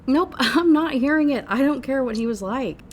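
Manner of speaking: loud, high, laughing dismissal; emphatic refusal. Acted. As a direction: shut it down loudly with a laugh. Dismissive outburst while reading.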